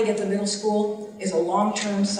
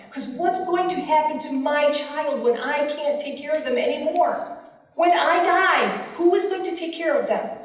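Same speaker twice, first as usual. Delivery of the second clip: higher, urgent distressed plea; anguished pleading; 6 semitones higher than usual. Retold or imitated. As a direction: voice the parents' fear, rising high. Parents' fear for their children.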